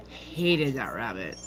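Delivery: a slow, low, quiet echo of the grudge; low bitter repeat. Real, not acted. Bitter repeat about mom.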